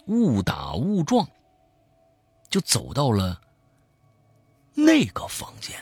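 dramatic storytelling. A narrator's reading. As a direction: build the panic like a storyteller. Tells a horror story aloud.

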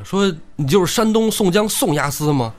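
a short bandit rushing over, eagerly asking the captive's name; eager question. Acted. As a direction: ask with sudden excitement. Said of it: "这小矮个儿过来就说".

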